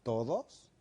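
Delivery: "todos" echoed low with a questioning rise. Shown as a demonstration. Coach questioning "all men are bad" in a low echo. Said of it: "con un tono bajo".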